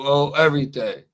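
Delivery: normal talking voice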